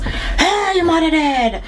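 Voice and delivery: high, blunt Jamaican call; Jamaican-style blurt. Retold or imitated. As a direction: toss it out bluntly in Jamaican English. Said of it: "that's Jamaican for you".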